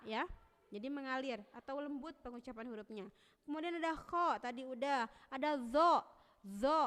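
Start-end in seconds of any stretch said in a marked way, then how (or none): 5.3-6.8 "zo" loud, buzzing on; zo demo. Shown as a demonstration. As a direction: hold the z of zo. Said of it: "zo mah kan tidak tertahan".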